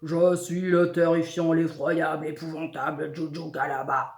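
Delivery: big, loud genie roar; bellowing monster boast; enormous monster voice. A narrator's reading. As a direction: announce yourself in a huge, booming voice. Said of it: "crie d'une énorme voix".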